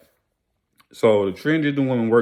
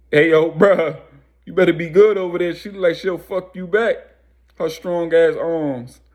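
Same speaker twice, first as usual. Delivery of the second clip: high, raised-voice heckle, acted out; calling out; 7 semitones higher, 4 dB louder than usual. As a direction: call over to him like a teasing bystander. Passerby calls out a warning.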